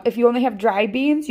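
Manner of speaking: ordinary talk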